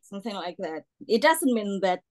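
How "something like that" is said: ordinary delivery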